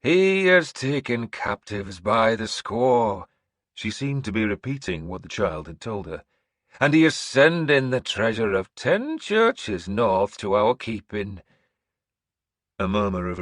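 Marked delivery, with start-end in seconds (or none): 0.0-3.3 slow, high, loud proclamation; old seeress announcing. Acted. Seeress announcing to the hall, high and slow. Breda relays child's words. 6.8-11.4 slow, high, loud proclamation; old seeress announcing. Acted. Seeress announcing to the hall, high and slow.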